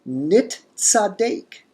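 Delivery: nit tzadek slowly and high, nit then tzadek; slow expected form. Shown as a demonstration. Teacher giving the expected form slowly, nit tzadek. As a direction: stretch nit tzadek, t first. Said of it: "we would expect".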